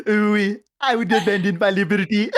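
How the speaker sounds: put-on high, laughing French accent; mock French lover, French accent; giggling French accent. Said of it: "in a French accent".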